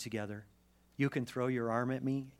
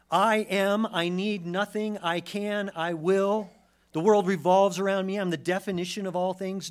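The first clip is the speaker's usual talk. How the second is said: loud, raised boastful proclamation; mock self-importance; 8 semitones higher, 10 dB louder than usual. Preacher mimicking an expressive individualist, mock self-important boast. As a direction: declare it like the centre of the universe.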